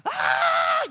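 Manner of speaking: very high-pitched scream; piercing mock shriek of pain; imitated blood-curdling yell. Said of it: "blood-curdling yelling".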